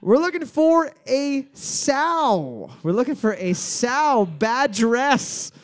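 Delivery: slow, high, deliberate announcement; host savoring the answer; drawn-out reveal. Acted. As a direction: stretch every word of the answer. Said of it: "slow drawn host answer".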